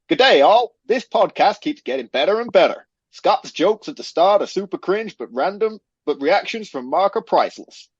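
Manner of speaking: badly faked Australian accent drifting American; botched Australian accent. Host reading a listener's review aloud, botched Australian accent. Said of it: "Why do I sound American?".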